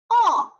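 high-starting 哦 that falls; fourth tone. Shown as a demonstration. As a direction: drop 哦 firmly downward. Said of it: "连贯的说一下".

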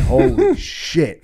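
high, slow, drawn-out amazement; stunned. Retold or imitated. Narrator seeing the jacked neighbor charge, slow and high in awe. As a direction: let it out slowly, awed by the huge guy. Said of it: "And I'm like".

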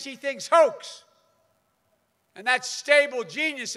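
ordinary delivery

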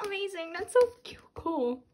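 normal talking voice

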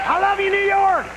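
high, exuberant crowd-greeting drawn out; Bill Clinton. Acted. Clinton greets his New York crowd, exuberant. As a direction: play a president whooping up the crowd. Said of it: "President Bill Clinton".

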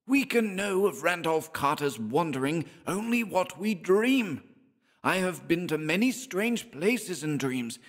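put-on high, harsh, shrill elderly voice; harsh shrill old man. A narrator's reading. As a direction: screech it out like a cranky old man. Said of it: "spoke in a harsh, shrill voice".

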